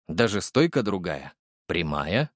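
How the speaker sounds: plain reading voice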